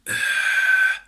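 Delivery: very high loud rasped scream; fry scream. Shown as a demonstration. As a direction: scream through compressed throat. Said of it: "you get to the fry scream".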